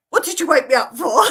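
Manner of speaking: loud, fast, laughing mock grumble; mock grumpy waking. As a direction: grumble like a man just woken. Husband woken too fast.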